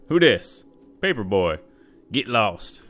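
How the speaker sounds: loud challenge. Acted. Knocking, voicing NPC at door.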